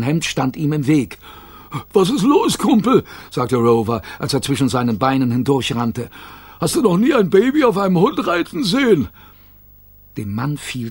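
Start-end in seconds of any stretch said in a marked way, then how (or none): 1.9-3.0 higher, louder cheeky dog voice; cheeky dog Rover. A narrator's reading. Rover running past a tourist, higher cheeky quip. 6.6-9.1 higher, louder cheeky dog voice; cheeky dog Rover. A narrator's reading. Rover running past a tourist, higher cheeky quip.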